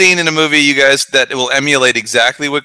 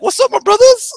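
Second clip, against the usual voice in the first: very high, eager mock greeting; nervous greeting, very high; squeaky try-hard greeting. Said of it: "He's like".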